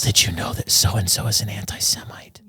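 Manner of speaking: hushed, conspiratorial imitation of a gossip; put-on secretive whisper, soft and voiceless; mock gossipy whisper. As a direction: whisper it like passing on a scandal, play-acted. Acts out gossip behind backs.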